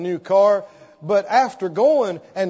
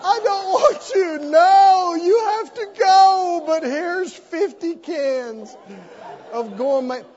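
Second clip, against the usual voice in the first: high, hammed-up sobbing plea to the cat; mock crying; 8 semitones higher, 4 dB louder, slower than usual. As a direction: wail at the kitty as if in tears. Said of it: "Crying, going".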